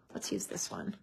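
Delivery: breathy aside under her breath; whispered to self. Real, not acted. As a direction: whisper it to yourself. Muttering to herself while crafting.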